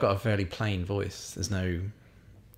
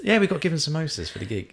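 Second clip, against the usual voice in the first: higher-pitched put-on Brummie; Birmingham accent; 8 semitones higher than usual. Shown as a demonstration. Chatting about gig samosas, still in a high Brummie accent. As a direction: keep up the Birmingham lilt. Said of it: "All I can do is Birmingham".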